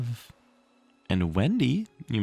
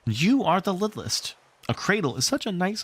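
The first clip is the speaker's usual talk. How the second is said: motherly Wendy; 10 semitones higher than usual. Acted.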